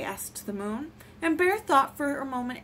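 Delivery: ordinary delivery, nothing marked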